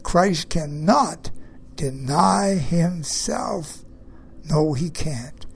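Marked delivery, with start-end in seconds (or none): 0.0-3.8 slow, deliberate "cannot", with weight on NOT; stress on NOT. Real, not acted. Preacher repeating the verse for his listeners, stressing "not" in "cannot". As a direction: spell it out slowly, pressing on the "not" of "cannot". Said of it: "I emphasize the word not".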